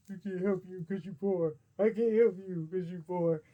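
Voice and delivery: mock character refusing a beggar; put-on reply voice. Replying to the joking plea for help, put-on voice. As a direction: answer in a silly altered voice. Answers own plea in another voice.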